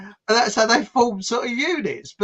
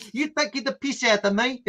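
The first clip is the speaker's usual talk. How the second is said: higher, mock-offended Rhodesian accent; 6 semitones higher than usual. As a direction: challenge them as an imitated Rhodesian. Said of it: "about his accent".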